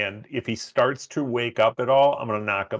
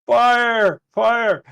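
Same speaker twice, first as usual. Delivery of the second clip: raised, high yell of alarm; yelling fire; 11 semitones higher, 7 dB louder than usual. Pretend. Player pitches a plan to fake a rescue, yelling. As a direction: call out a false alarm, loud and high. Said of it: "We start yelling".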